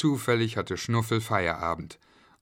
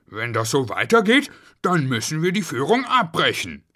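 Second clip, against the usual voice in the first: stern warning, raised voice; father's loud threat to stop; scolding; 6 semitones higher, 6 dB louder than usual. A narrator's reading. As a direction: warn the children crossly, getting higher. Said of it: "schimpfte Schnuffel".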